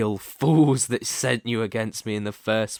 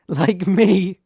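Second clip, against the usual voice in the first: menacing boast; 7 semitones higher, 7 dB louder than usual. Acted. Villain boasts of his power.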